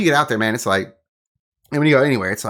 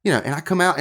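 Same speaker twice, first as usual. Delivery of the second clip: ordinary delivery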